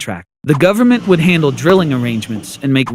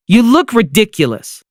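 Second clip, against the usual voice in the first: scornful; 6 semitones higher than usual. Acted. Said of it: "clearly annoyed".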